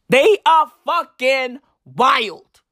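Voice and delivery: slow stretched stress on "wild"; drawn-out emphasis. Real, not acted. Vlogger hammering home how crazy the headlines are, slow drawn-out emphasis. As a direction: draw out "They are fucking wild" word by word. Emphatic repeat of his verdict.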